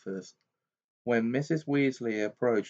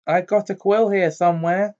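higher, louder flustered voice; anxious mother; 8 semitones higher, 7 dB louder than usual. A narrator's reading. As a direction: fluster while rummaging. Mrs Weasley rummaging anxiously.

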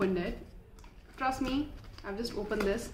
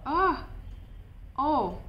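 short, raised "ah" and "oh" of discovery; surprised gasp; 4 semitones higher than usual. Real, not acted. Seeing the lip balm change color, surprised exclamation. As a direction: exclaim as it suddenly dawns on you.

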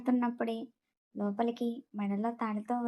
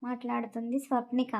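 ordinary delivery

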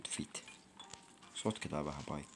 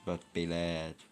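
ordinary talk

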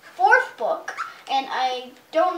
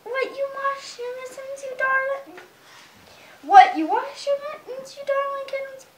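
higher cooing mother voice; mother cat praising her kittens for washing their mittens; delighted mother cat; 10 semitones higher than usual. Acted. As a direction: coo over the darling kittens.

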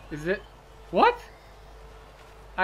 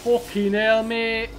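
loud, higher English-accented exclamation; 5 semitones higher, 6 dB louder, slower than usual. Shown as a demonstration. Gamer shows viewers his best English accent, loud mock English. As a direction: put on your best English accent and call it out. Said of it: "English accent you're gonna get".

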